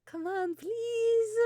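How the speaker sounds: high, whiny begging; pleading. Acted. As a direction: beg like a kid. Begging friend to go.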